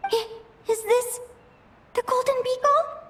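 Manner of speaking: put-on a lost girl trying to talk herself calm; younger dream self.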